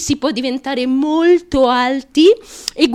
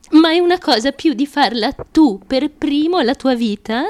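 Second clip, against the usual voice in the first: ordinary talk, nothing marked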